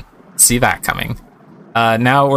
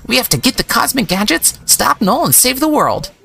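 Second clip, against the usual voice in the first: put-on much higher, heroic young voice; triumphant Pablo; 13 semitones higher than usual. Pablo rallying the professors to save the world, high and heroic. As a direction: declare it like a plucky hero. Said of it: "standing up triumphantly and says".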